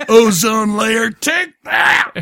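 drawn-out jeer breaking into a high yell; hammed-up triumphant jeering, laughing; mock defiant taunt. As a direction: taunt them with gleeful pretend defiance. Mock defiant taunt from his car.

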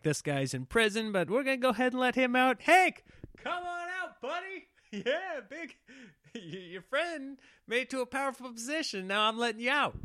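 high, fast hammed-up president; gleefully free your buddy; mock president voice. President freeing his friend, acted.

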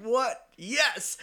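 high, loud surprised then thrilled; excited yelp. Retold or imitated. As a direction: jump at the sight of the moose. Moose suddenly runs at him.

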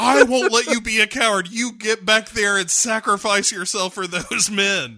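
loud hammed-up threat; over-the-top mock yell. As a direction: shout him back to duty. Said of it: "is like".

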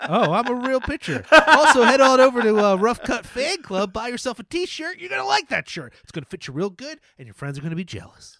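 high, loud salesman hype; snake oil seller. Acted. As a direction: oversell it like a huckster. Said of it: "your pitch man voice".

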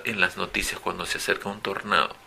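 ordinary delivery

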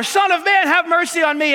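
high, loud plea for mercy; retold loud cry. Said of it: "He just screams even louder".

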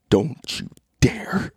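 soft, slow mimed warning; mock menace, barely voiced; mouthed threat. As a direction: imitate her silently mouthed threat, drawn out. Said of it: "but you mouth to me".

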